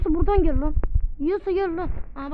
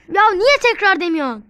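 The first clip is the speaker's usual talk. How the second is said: irritated yell; 5 semitones higher, 10 dB louder than usual. Real, not acted. Scolds friend for not repeating.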